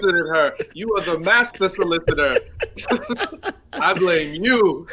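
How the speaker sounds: laughing through the words; real laughter, words slowed by giggles. Real, not acted. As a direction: tease him while cracking up. Laughing while teasing the co-host.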